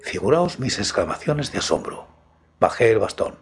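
usual speaking voice

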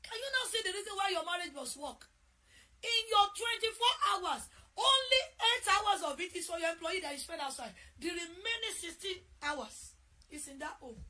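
loud, high cry about the sixteen hours; impassioned preaching. Real, not acted. Preacher pressing wives on why marriage must work, loud. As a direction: plead with them to see it.

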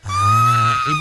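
excited shout. Real, not acted. Host reacts to caller flirting.

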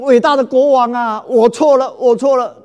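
loud, high wailing cry of a mother; wailing plea. Acted. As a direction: throw yourself down and wail for mercy. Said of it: "大声的嚎哭啊".

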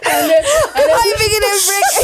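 repeating it laughing hard; laughing through words. Real, not acted. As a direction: laugh the line out. Repeats line while laughing.

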